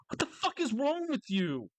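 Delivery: high, incredulous outburst; exasperated at God. Pretend. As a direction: demand it of God, fed up. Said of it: "I would say that to God".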